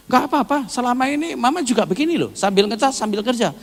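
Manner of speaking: high, rapid retort of a wife; quarrelling wife. Retold or imitated. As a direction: imitate a wife arguing back, fast and high. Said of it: "akhirnya ya ribut".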